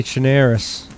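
"Chernarus" with an "air" vowel, cher-NAIR-us; New England pronunciation. Shown as a demonstration. Player showing how New England talks, "Chernarus" as cher-NAIR-us. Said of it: "the way we talk in New England".

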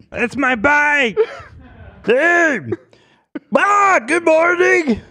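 high, slow squeaky voice; Pee Wee Herman voice. Pretend. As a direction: stretch it out, way up high. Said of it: "The Pee Wee Herman guy's like".

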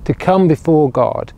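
usual speaking voice

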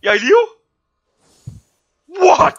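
loud surprised disbelief; amazed. Real, not acted. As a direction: cry out in disbelief. Surprised at surviving.

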